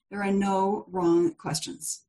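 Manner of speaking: "no wrong questions" said slowly, word by word; slow, measured reassurance on "no wrong questions"; slow deliberate repetition. Real, not acted. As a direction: repeat it at half speed, letting "no" and "wrong" land. Repeats it slowly for emphasis.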